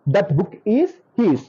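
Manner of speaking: very slow, each word spaced out for the class; slow dictation. Shown as a demonstration. Teacher dictating an example sentence, very slowly. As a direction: spell it out slowly so students can write. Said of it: "dictating the example sentence slowly".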